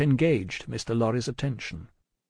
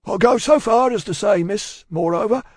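loud, high Cockney speechifying; pulpit oratory; 10 semitones higher, 6 dB louder than usual. A narrator's reading. Cruncher preaching to Miss Pross, solemn oratory. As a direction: hold forth as if from a pulpit. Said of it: "tendency to hold forth as from a pulpit".

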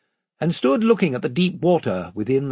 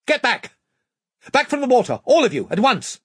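sharp shout; 10 semitones higher, 4 dB louder than usual. Acted. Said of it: "shouted out in the sharp voice".

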